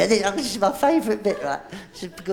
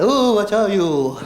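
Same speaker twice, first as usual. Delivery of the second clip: drawn-out mock-frightened ooh; mock scared; 3 semitones lower, 4 dB louder, slower than usual. Shown as a demonstration. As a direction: play at being scared, stretching the words. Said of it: "You gotta be scared, like".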